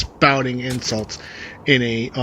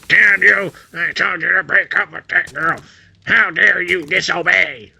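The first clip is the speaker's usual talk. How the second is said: raised, scolding rant; angry parent; 6 semitones higher than usual. Retold or imitated. Parents scolding their son for not breaking up, raised and angry. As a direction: tear into the disobedient son.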